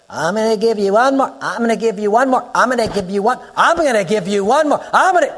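imitation of a nagging parent, repeated rising threats; mock parental warning. Preacher mimics parents giving children endless chances, mock warning.

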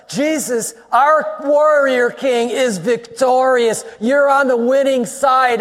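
high, driving preacher's delivery; full-force preaching. Real, not acted. Preacher tells congregation they are on the winning side, full force. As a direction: proclaim it to the whole congregation.

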